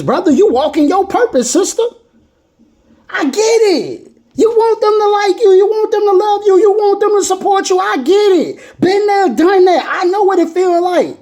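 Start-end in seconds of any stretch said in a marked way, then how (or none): 3.1-11.2 raised, earnest preaching voice; empathetic preaching. Acted. Preacher to the discouraged creator, earnest and raised. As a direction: lift it up, feeling it with them.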